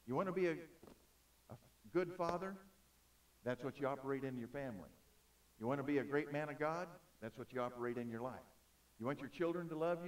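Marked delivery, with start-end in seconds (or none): none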